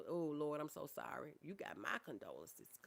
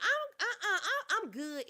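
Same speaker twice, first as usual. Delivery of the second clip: high, louder laughing reassurance; real laughing brush-off, much higher; 7 dB louder than usual. Real, not acted. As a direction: laugh through the words to wave it away. Laughs off viewer's apology.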